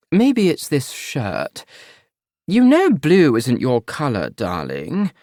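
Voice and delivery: mother making a cutting remark slowly; disapproving mother. Acted. Mother eyeing her son's boyfriend, slow and pointed.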